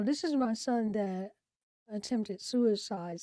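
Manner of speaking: normal talking voice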